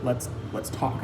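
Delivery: hushed plea. Retold or imitated. Girlfriend quietly pressing Zach to talk.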